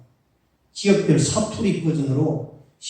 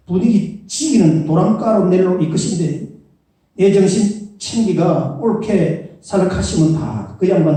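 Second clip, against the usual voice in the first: put-on higher, punchy Gyeongsang accent reading the psalm; Gyeongsang dialect; 4 semitones higher, 8 dB louder than usual. Pastor reading Psalm 23 in dialect, Gyeongsang accent. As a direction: give it a blunt Gyeongsang accent. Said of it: "그 다음에 경상도 보죠".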